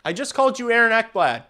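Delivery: high, loud, fast holler; exasperated yell. Real, not acted. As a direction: shout it at the player in a rush. Yelling at the same player.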